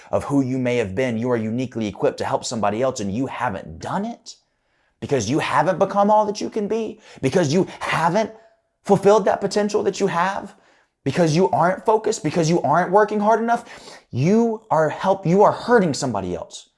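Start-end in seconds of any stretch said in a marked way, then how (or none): 5.0-16.7 much higher and louder, driving each "because" home; preaching at full force. Real, not acted. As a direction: preach it, build the pressure line after line. Speaker rises into full preaching.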